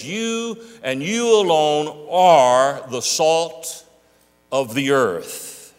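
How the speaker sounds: slow, stretched you and you alone; slow, deliberate, emphatic preaching; emphatic slow quote. Shown as a demonstration. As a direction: drag it out, every word weighted. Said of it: "present tense".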